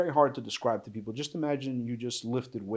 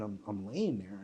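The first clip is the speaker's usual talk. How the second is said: ordinary talk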